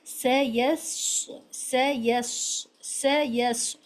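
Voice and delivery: س said light and thin, slowly drawn out; teacher demonstrating a light seen; thin seen sound. Shown as a demonstration. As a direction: stretch out a thin, hissing س. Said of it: "ٹی کی آواز کے ساتھ پڑھنا ہے".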